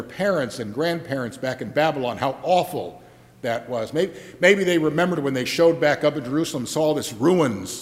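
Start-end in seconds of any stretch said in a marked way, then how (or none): none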